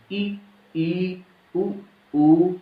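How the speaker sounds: ई, ई, उ, ऊ said with lips nearly closed, held clearly; vowel demo. Shown as a demonstration. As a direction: demonstrate the closed vowels ई and ऊ slowly. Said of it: "ऐसे करके".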